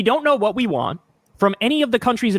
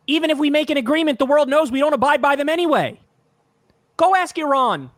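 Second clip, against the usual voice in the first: heated, higher pitch; 9 semitones higher than usual. Real, not acted. Ranting about broken US deals.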